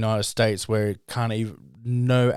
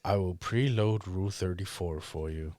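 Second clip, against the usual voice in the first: soft, slightly lowered computer voice; Hal; 3 semitones lower, 9 dB quieter than usual. Acted. Computer offering its owner porn, soft mock machine voice. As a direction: offer it quietly, like an obliging machine. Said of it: "it's gonna be Hal's voice".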